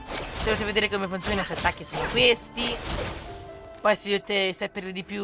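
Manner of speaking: ordinary talk, nothing marked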